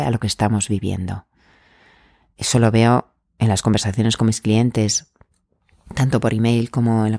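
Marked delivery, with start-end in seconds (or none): none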